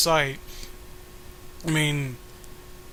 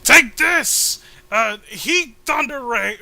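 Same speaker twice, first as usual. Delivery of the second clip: high, loud anime move shout; attack callout; 7 semitones higher, 6 dB louder, much slower than usual. Acted. Hero firing a special attack, shouted callout. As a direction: yell the attack name like a cartoon hero.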